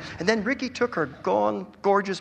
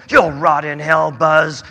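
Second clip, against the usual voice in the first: hissed; 8 dB louder, slower than usual. A narrator's reading. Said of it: "he hissed".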